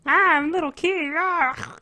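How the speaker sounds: put-on high, loud childish squeal; little kid voice. Mocking a kid who bit someone, high squeal. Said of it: "little kid just fucking bit him".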